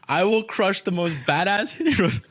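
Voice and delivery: high, loud, slow and laughing; incredulous, hammed-up repeat of a boast; mock threat. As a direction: parody the threat through laughter. Mockingly repeating the boss's threat, laughing.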